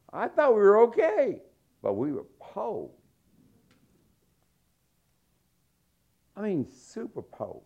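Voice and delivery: high, loud, building "po" cries; excited preaching. Real, not acted. Preacher telling the crowd his family was poor, high excited cries. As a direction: belt out how poor you were. Comic emphasis on "po.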